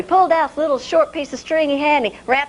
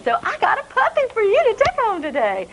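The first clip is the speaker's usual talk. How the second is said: higher, sweet auntie offering a gift; auntie's voice; 9 semitones higher than usual. Acted. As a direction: coax the boy kindly. Auntie speaking to boy.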